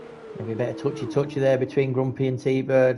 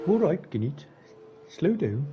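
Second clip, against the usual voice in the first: put-on Guinnett's regional British accent, higher, vowels bent as in slu du; the driver's accent; 4 semitones higher than usual. Commentator mimics Guinnett's own accent at him, playful British accent. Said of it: "We'll say it in his accent".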